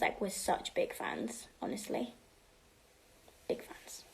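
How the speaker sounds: fading to a whisper; whispered, dropping away. Real, not acted. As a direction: sink into a hushed confession. Trails into whisper.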